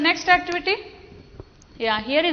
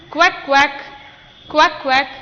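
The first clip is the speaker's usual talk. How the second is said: loud, clipped duck quacks for the class; 3 dB louder than usual. Shown as a demonstration. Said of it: "Repeat after me".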